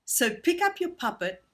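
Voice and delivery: normal talking voice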